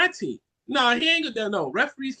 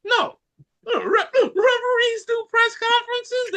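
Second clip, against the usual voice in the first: incredulous high voice; 13 semitones higher, slower than usual. Real, not acted. Mock outrage, cry heard.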